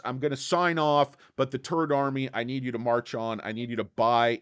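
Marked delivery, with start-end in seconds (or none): none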